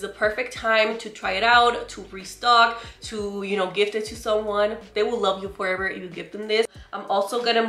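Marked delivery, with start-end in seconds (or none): none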